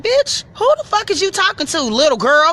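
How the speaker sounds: retelling her own furious comeback; outraged retort. Retold or imitated. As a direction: put her in her place, loudly.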